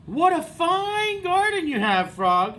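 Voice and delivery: a hint of an eager Toad; Toad's voice.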